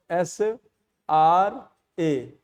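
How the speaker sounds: एस आर ए, each letter drawn out slowly; spelled out slowly. Shown as a demonstration. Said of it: "स्र को हम ऐसे लिखेंगे". (अक्षर अक्षर धीरे धीरे)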